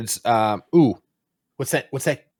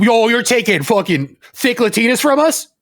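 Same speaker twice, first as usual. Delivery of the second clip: high-pitched indignant protest; mock outrage; 9 semitones higher than usual. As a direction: protest in hammed-up disbelief. Acting out a resistance fighter's outrage.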